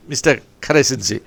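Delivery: ordinary talk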